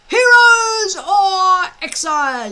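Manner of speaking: loud, high group shout; boys yelling. Acted. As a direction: shout it back in frantic chorus. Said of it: "yelled eighteen boys".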